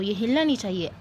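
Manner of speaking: normal talking voice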